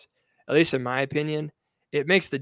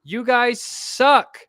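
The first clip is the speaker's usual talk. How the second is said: slow, raised, emphatic put-down; scornful rebuke; 7 semitones higher, 4 dB louder, slower than usual. Acted. Host telling lawmakers off over failed oversight, scornful. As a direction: hammer each word of the insult, higher and louder. Mock rebuke of absent politician.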